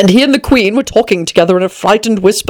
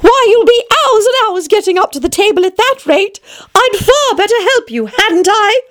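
put-on high, girlish character voice; Alice; 10 semitones higher than usual. A narrator's reading. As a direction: offer help in a high little-girl voice. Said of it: "till at last she said".

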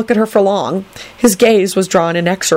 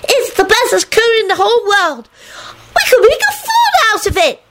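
happy scream. A narrator's reading. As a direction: squeal happily, drawing out the words. Natalie's happy screaming goes on.

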